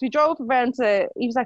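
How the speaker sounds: normal talking voice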